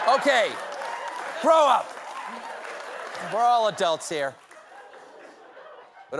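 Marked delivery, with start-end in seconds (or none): 0.1-4.4 loud, high mock scold. Real, not acted. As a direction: tell the crowd to grow up. Scolding the laughing audience.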